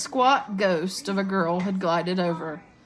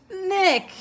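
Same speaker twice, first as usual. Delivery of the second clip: high, loud hearty shout; booming hail, pitched up; roar; 13 semitones higher, 5 dB louder than usual. A narrator's reading. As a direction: roar a greeting to a friend. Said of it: "he roared".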